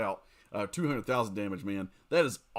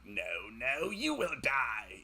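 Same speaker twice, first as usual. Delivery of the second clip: a lightly voiced sinister emperor, a touch softer; dragging out a mild Palpatine threat; 3 dB quieter, slower than usual. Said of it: "like, Emperor Palpatine".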